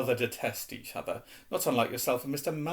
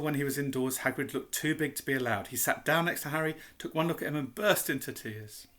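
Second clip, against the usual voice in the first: ordinary delivery, nothing marked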